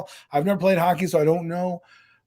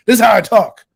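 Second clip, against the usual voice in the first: loud, emphatic burst; 4 semitones higher, 11 dB louder than usual. Real, not acted. Defending their natural talking voice to critics, loud. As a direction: blurt it out loudly, pitch raised. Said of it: "I can't help that I'm loud".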